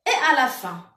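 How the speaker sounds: usual speaking voice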